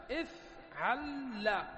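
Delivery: افعل drawn out very slowly, with a doubled ل at the end; slow pattern demo. Shown as a demonstration. Teacher naming the third verb pattern, slow and drawn out. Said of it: "الوزن الثالث".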